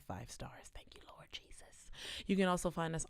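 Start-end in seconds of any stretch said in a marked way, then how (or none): 0.0-1.5 hushed aside; whisper. Real, not acted. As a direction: breathe it out in a whisper.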